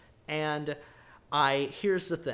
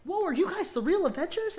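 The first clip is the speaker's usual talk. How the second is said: put-on much higher excited kid voice; 5 dB louder than usual. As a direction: squeak it like an awed kid. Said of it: "in, like, his kid voice".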